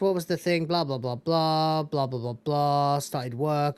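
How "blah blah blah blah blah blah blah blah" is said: slow mocking blah blah; dismissive blah blah. Real, not acted. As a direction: drone it out dismissively. Dismissive filler.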